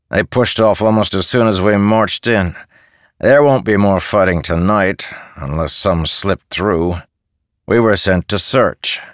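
put-on a hard man brushing off a boy; curt soldier. Sunken-eyed soldier tells the shepherd the raiders fled, brusque.

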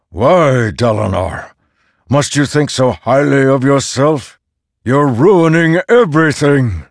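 high, loud, forceful rebuke; thundering. A narrator's reading. Storm god accusing Dalinar of ruining everything, thundering. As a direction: thunder at him, building to full force. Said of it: "against the force of the words".

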